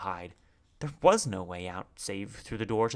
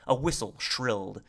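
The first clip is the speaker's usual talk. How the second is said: plain reading voice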